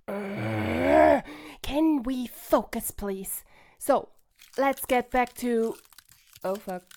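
usual speaking voice

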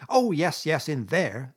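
high, loud impatient brush-off. A narrator's reading. Toad brushing off badger's reminder, impatient. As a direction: wave the objection away. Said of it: "said Toad impatiently".

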